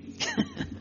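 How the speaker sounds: soft high chuckle; quiet, high-pitched little laugh; brief amused chuckle to oneself; real laugh. Real, not acted. Laughs at not saying it publicly.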